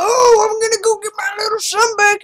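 high, loud desperate yell; frantic shout. Real, not acted. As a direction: shout it in panic. Chasing lost son.

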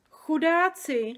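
high, slow, pitying cry; pitying exclamation. A narrator's reading. Sofie pities the whipped dreams, high slow cry. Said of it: "zvolala Sofie".

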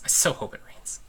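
unvoiced whispered wish; real whispered hope. Real, not acted. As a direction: breathe it as a secret. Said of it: "whispered repeat".

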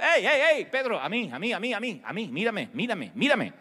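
high, urgent call to Peter. Acted. Jesus calling to Peter over the storm.

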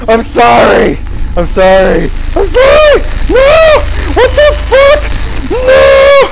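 high, loud panicked apologies; frantic yelling. Real, not acted. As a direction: shout in real panic. Monster attack, frantic apologies.

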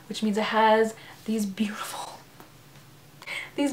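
hushed whisper over the edges; whispered awe. Real, not acted. Showing off sprayed edges to viewers in a hushed whisper. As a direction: whisper it as if the edges might break.

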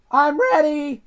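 high-voiced little engine; high, eager storybook reply. A narrator's reading. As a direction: pipe up that you're ready. Eager Edward answers.

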